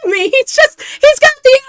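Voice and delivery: very high, loud gushing falsetto; swooning crowd. Acted. Townsfolk gush over their dreamy idol, high swooning squeal. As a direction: squeal with hammed-up adoration.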